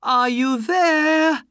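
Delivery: loud, high, drawn-out call; long searching holler; crying out. A narrator's reading. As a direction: shout up to see who is there. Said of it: "he cried".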